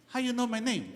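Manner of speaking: lightly put-on voice, startled; man bumping into him on the street. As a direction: play a passerby surprised.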